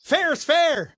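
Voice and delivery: hammed-up battle cry; mock defiant slogan. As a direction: declare it like a heroine's catchphrase. Quoting the girl's promo line.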